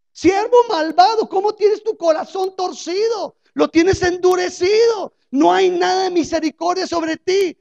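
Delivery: loud, high furious rebuke of the servant; angry king's rebuke. Acted. The preacher voicing the angry king at the servant, loud furious rebuke. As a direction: thunder at the wicked servant, shouting.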